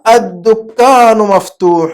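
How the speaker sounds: slow, stretched Arabic, doubled د; model reading. Shown as a demonstration. Teacher repeating the pupil's sentence correctly, slow drawn-out reading. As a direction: draw out each long vowel. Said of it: "د بولتے وقت زبان شامل ہے".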